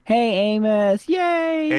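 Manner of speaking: high, singing-out hello; playful cheer. Pretend. Greeting a favourite character with cheer.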